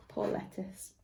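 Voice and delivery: soft whispered sympathy. A narrator's reading. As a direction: breathe it out in a whisper. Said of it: "whispered aside to listeners".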